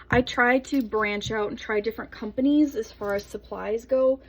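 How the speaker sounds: ordinary delivery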